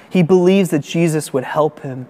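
ordinary talk

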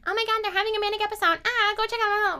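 very high, fast, frantic gossip; mock gossip panic. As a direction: gasp it like a nosy busybody. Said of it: "just be like".

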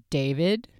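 DAVID rising as a question; a voice asking "David?". Retold or imitated. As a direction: ask DAVID with the pitch lifting at the end. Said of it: "Like, it's a question".

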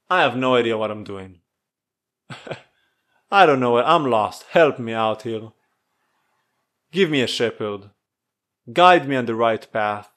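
low, earnest plea to God; humble prayer. Acted. Speaker voices a humble prayer.

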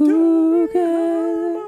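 high, far put-on ooh girl; sassy diva. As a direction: camp it up like a diva. Diva voice after song.

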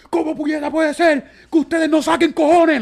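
high, loud mock rant of the radio host; imitating angry radio host. Radio host ranting at Puerto Rico, mock furious shout. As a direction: ham up the outraged host yelling at the public. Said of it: "Lo dijo así, dijo".

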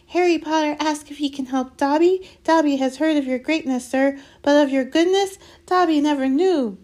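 high, loud elf voice; excitable house-elf. Acted. Elf overwhelmed by Harry's offer of help, gushing. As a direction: gush in a high-pitched elf squeal. Dobby's voice in narrated story.

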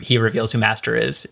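ordinary talk

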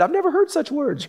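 high, prim little voice of a shocked David. Acted. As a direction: squeak it out like a scandalised boy. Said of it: "And David said".

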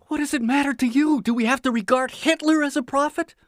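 high, loud indignant outburst; burst out. Acted. Prisoner snaps back at his neighbor, loud and indignant. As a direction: explode at him in disbelief. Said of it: "I burst out".

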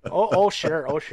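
lightly put-on Chicago-area accent; teasing Upper Midwest accent; mock Chicago accent. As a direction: play along in a Midwestern accent. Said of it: "in a Chicago Northwest accent".

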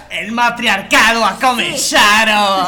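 loud, drawn-out announcement; mock proclamation. Host teasing the women taking over, mock-solemn proclamation. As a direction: proclaim it grandly, half laughing.

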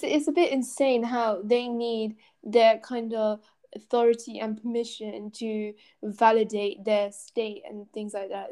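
usual speaking voice

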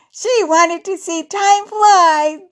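high, loud, slow joke delivery; amused punchline. A narrator's reading. Jenna answering her own joke, high laughing delivery. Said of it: "Jenna laughed".

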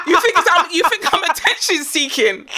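ordinary talk

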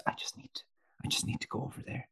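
timid whispered plea, quick at the end; polite whisper. Retold or imitated. Asking chatting women to move from his car, in a polite whisper. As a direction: whisper it apologetically, as if not to disturb. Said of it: "that polite point where you're just".

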